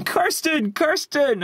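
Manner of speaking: high calling out a name; high-pitched call for a cousin; retold shouting. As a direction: call her name up high across the house. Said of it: "and I'm going".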